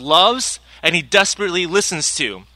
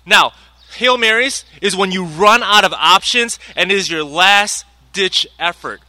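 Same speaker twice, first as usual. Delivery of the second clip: ordinary talk, nothing marked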